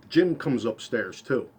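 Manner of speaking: usual speaking voice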